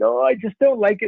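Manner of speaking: high-pitched mock whimper; put-on childish near-crying whine; imitating tearful daughter. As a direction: play a little girl holding back tears. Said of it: "crying a little bit and trying to hold them back".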